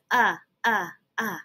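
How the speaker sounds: clipped repeated uh; sounding out U for umbrella; short u sound. Shown as a demonstration. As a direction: model the uh sound. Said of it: "Do you hear an uh".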